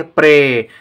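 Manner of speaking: प्रे said alone, half प then रे; sounding out a syllable. Shown as a demonstration. Teacher models the प्रे syllable for pupils, crisp. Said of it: "प्रे किस तरह से आवाज़ आती है".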